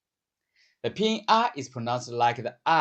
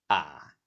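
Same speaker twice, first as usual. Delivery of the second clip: "ah" low and dipping; third tone; 9 semitones lower than usual. Shown as a demonstration. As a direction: drop "ah" to the bottom of the range. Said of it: "let's sing ah with the four tones".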